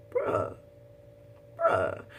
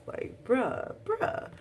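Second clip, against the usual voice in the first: very high exasperated bruh; 9 semitones higher, 3 dB quieter, much slower than usual. Real, not acted. Viewer reacting to the betrayal, exasperated. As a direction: groan at the betrayal.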